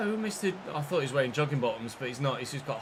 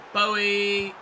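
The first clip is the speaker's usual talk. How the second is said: loud, higher excited call; raised, drawn-out commentator's cry; 6 semitones higher, 7 dB louder than usual. Real, not acted. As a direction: call the striker's name as the chance comes. Urging striker on goal.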